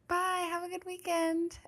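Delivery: high, sing-out goodbye; friendly acquaintance. Retold or imitated. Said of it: "this girl was like".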